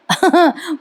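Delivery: brief high chuckle; amused laugh. Real, not acted. Narrator amused at the husband's excuse, short chuckle.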